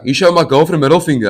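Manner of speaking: imitating an angry boyfriend; mock angry accusation. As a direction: throw the accusation like a riled boyfriend.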